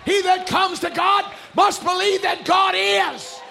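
high, loud scripture proclamation; full-force preaching. Real, not acted. Preacher declaring God's promise to the church, full-force preaching. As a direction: thunder the promise, stretching key words.

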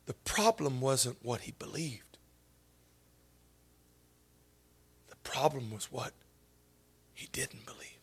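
soft, low whisper; hushed whisper. Acted. Preacher whispering his insight to the congregation. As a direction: lean in and whisper the revelation.

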